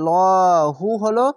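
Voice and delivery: লহু with a thick dark ল, drawn out; heavy lam. Shown as a demonstration. Teacher showing the heavy lam in লহু. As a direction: say লহু heavy and full-mouthed. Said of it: "তাহলে মোটা করা হবে".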